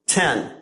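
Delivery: "Ten" with open mouth and dropped tongue; short E. Shown as a demonstration. Teacher models "Ten" for students to repeat, short E. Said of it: "So now say ten again".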